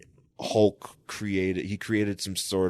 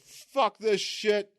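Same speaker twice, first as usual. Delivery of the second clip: high, strained monster voice, starting slow; hammed-up brute determination, pitched up; Hulk; 13 semitones higher than usual. Hulk resolving to look for Betty, high put-on voice. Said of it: "and then Hulk's like".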